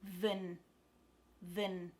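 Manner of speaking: VIN with a loose, neutral vowel; unstressed VIN, vowel reduced to uh; relaxed schwa. Shown as a demonstration. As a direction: relax the mouth completely on VIN. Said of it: "just relax your mouth".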